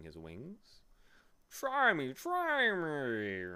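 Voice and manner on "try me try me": high, loud bee challenge; boastful bee. A narrator's reading. Bee challenging Milo to test its spelling, high eager brag. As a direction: dare them in a high, stretched bee voice. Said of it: "he boasted".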